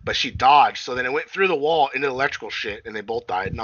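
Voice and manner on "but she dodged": usual speaking voice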